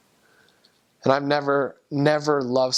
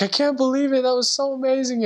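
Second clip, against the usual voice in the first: much higher, hammed-up astonishment; mock amazement; 12 semitones higher than usual. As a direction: play the companion overdoing his disbelief. Companion's ironic amazement retold.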